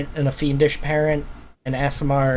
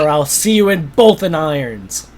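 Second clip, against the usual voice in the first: stern lord; 3 semitones higher, 3 dB louder than usual. Acted. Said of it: "the Lord steps in and he was like".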